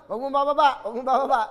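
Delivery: loud urgent don't hang up; urgent plea. Real, not acted. Host begs the caller to stay on the line, urgent. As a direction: beg her loudly not to hang up.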